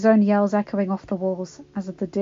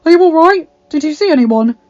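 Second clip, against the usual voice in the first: anxious; 7 semitones higher, 14 dB louder than usual. A narrator's reading. Adam anxiously checking on Belle.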